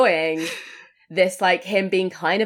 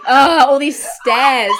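slow, loud, high groaning killer; weary killer voice; 7 semitones higher, 8 dB louder, much slower than usual. Retold or imitated. Retelling the tired killer on the stairs, slow loud groan. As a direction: drag it out like an exhausted slasher. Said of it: "him being like".

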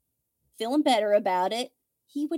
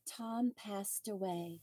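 much softer, slower, mock-gentle; soft euphemism; 2 semitones lower, 13 dB quieter, slower than usual. Shown as a demonstration. To a friend, mocking the softer wording, slow and hushed. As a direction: lay the euphemism on quietly. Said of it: "Would it make you feel better if I say".

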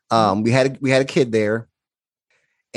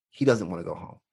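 softer, calm knowing statement; gently explaining to startled coworkers, softer; quiet calm; 5 semitones lower, 8 dB quieter than usual. Retold or imitated. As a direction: say it softly, as if you just know. Calmly explaining to shocked staff.

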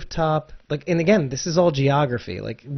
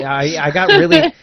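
much higher and louder rush; excited; 8 dB louder than usual. Real, not acted. Agreeing with cohost about Talking Dead, excitedly high. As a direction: jump in eagerly, voice way up.

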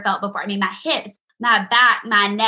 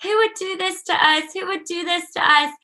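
distraught cry; 8 semitones higher than usual. Retold or imitated. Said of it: "one of them was like".

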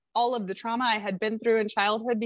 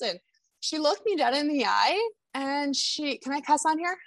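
ordinary talk

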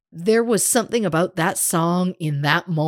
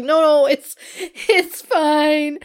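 mock sobbing reassurance; 10 semitones higher, slower than usual. She reassures while sobbing.

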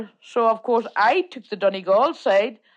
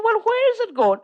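put-on higher, startled question to the old man; mock-alarmed asking where the bus goes; retold alarm; 11 semitones higher than usual. Lost woman asks fellow passenger, startled and higher.

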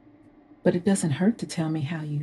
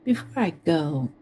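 ordinary talk, nothing marked

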